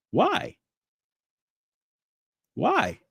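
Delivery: high, pressing repeated why; outraged why. Real, not acted. Demanding answers from viewers, high and outraged.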